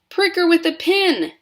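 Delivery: very high, loud frantic voice; another father. A narrator's reading. Another father blurts a remedy, high and loud. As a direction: blurt out a panicked idea. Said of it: "said one of the other fathers".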